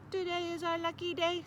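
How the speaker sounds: cheerful toy robot boast; robot dog voice. Acted. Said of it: "said Torpo".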